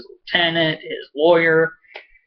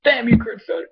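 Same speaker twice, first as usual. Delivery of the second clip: high, playful outrage at the writer; mock curse; 10 semitones higher, faster than usual. Real, not acted. Fan playfully cursing the showrunner, high mock outrage. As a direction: shake a fist at the creator.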